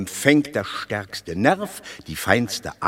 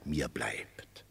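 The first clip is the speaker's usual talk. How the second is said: whisper; 11 semitones lower, 15 dB quieter than usual. Acted. The belly confides how little it keeps, whispered. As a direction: sink to a whisper at the end.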